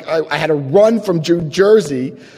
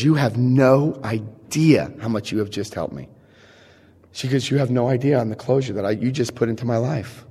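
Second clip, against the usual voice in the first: quiet sincerity; 5 semitones lower, 6 dB quieter than usual. Retold or imitated. Her parting speech at the door.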